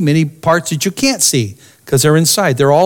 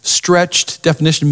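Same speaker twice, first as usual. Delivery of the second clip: ordinary talk, nothing marked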